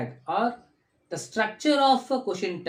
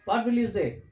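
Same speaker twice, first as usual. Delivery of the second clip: ordinary delivery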